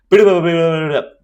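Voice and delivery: mock stuttering in a rush; imitating rushed stutter. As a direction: mimic someone tripping over Peter Piper. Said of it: "how they end up speaking about it if they speak fast".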